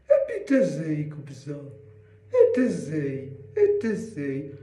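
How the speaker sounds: put-on girl voice. Shown as a demonstration. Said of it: "fais-le avec une voix de fille".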